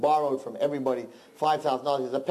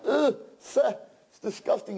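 high revolted groan; husband tasting the bird, disgusted; disgusted peasant; 10 semitones higher than usual. Acted. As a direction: gag on the taste.

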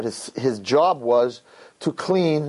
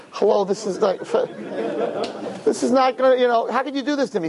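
whiny protest; 9 semitones higher than usual. Acted. Protest at forced marriage.

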